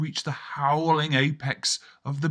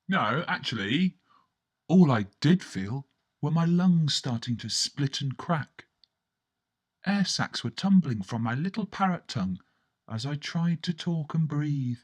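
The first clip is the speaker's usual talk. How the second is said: ordinary delivery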